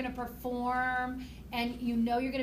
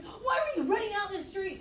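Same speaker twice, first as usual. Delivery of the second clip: higher, mock scolding yell at a child; scolding mom; 7 semitones higher than usual. Mom scolding her child for running into the street, higher mock yell. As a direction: scold the kid who ran out. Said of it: "starts going".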